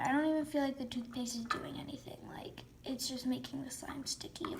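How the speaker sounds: soft whispered aside; whispering. Real, not acted. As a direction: drop to a whisper. Aside about the toothpaste.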